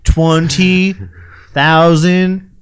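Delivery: slow, each word stretched apart; slow drawl, word by word; slow drawn-out. Shown as a demonstration. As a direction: drag out twenty, thousand, dollars one by one. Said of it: "I'm not from the South".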